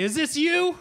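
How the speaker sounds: a fan checking a photo; eager fan. Retold or imitated. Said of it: "they'd say".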